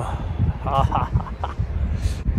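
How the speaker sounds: drawn-out soft laugh. Real, not acted. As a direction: laugh softly in spaced ha-ha-ha beats. Laughs at the night's cold task.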